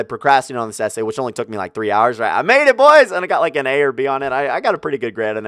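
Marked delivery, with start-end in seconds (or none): 2.4-3.1 loud, high victory cry; triumphant shout. Acted. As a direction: crow it out like a win. Triumphant shout after finishing essay.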